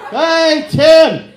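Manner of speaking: loud, high drawn-out call; mock jeering shout. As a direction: holler mockingly at Tim. Mock heckling an audience member.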